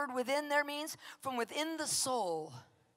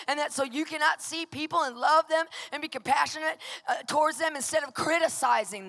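loud, hammering preacher cadence on soul; fiery preaching; 3 semitones higher, 6 dB louder than usual. Acted. Pastor exhorting the congregation, loud fiery preaching. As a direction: preach it at full force to the congregation.

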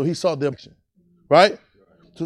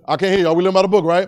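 louder, prompting preacher; rallying call; 4 semitones higher, 6 dB louder than usual. Real, not acted. Preacher prods a congregation he can't hear, rallying call.

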